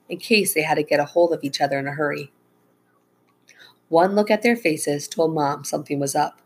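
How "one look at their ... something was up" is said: ordinary delivery, nothing marked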